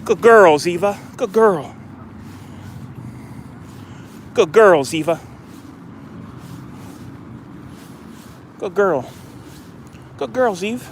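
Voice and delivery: high, sing-song praise for a dog. Real, not acted. Owner praising his dog after she fetches, high and sing-song. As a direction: coo it up high like praising a pet.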